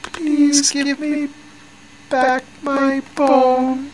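tiny high voice, drawn out slowly; small voice. A narrator's reading. Small voice asks the woman for its bone back, high and slow. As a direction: plead in a slow, very high little ghostly voice. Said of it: "she heard a small voice".

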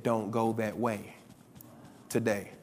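very low, soft and slow; retold quiet warning. Preacher quoting God's morning warning, hushed and low. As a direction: drop right down, deliver it slowly.

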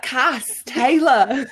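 put-on slow, drawn-out Australian accent on "Cass, Taylor"; a laughing Australian take on the contestants' names. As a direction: stretch the names out with a playful Australian lilt. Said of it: "you have to be like".

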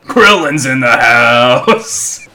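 low, slow cartoon shout; Krillin voice. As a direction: bellow it like Krillin. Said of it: "it's in the Krillin voice".

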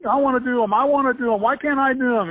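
high pleading child voice, imitated; whiny little kid. Little brother pestering Dad to join in, imitating a high whiny plea. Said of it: "Stu kept bugging my dad".

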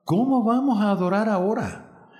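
lightly acted exclamation of dismay; exclaimed dismay. A narrator's reading. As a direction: narrate a director's dismayed outcry. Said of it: "exclamó".

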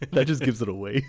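laughing through the line; can't hold back the laugh; words squeezed out mid-laugh. Real, not acted. Laughing at his own joke.

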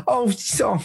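high, caught-off-guard little gasp of words; mock flustered, surprised stranger. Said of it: "gets all flustered".

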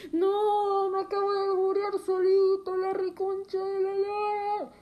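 high loud outcry; hammed-up panic, yelled high; dramatic shout. Chokes, dramatic outburst. (grito dramático y agudo)

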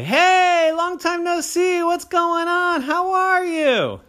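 very high, loud, slow squeaky greeting; high cartoon greeter; cheery acquaintance. A narrator's reading. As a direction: sing it out like an overeager pal. Somebody greets Oren.

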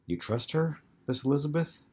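rising note of skepticism; skeptical. A narrator's reading. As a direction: question it with doubt starting to show. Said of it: "his skepticism beginning to show through".

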